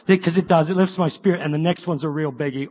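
normal talking voice

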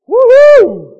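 very high, very loud whoop; exultant whoop. Acted. John exults at being born full of it, whooping. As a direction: let out a huge joyful holler. Cheering as baby filled with Spirit.

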